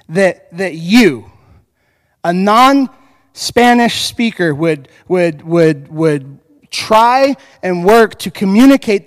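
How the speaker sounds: slow, tender gratitude; heartfelt. Retold or imitated. Congregants thanking the pastor, slow and tender. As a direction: let each word land slowly. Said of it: "this is what they told me".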